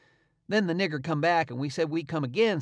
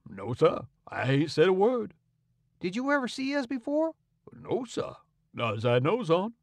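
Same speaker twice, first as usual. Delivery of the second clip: put-on cautious old slave; 5 semitones higher than usual. A narrator's reading. Jim carefully denying to Tom that he knows them. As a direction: deny it respectfully. Jim denies knowing boys.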